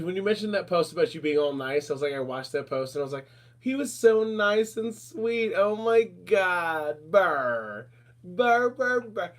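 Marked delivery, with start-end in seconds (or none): 3.6-9.3 slow cooing puppy talk with "burr" trills; puppy mode. Retold or imitated. Gushing over his nice post in mock puppy mode. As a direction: coo over him like a puppy, drawn out. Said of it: "I was in full puppy mode for that".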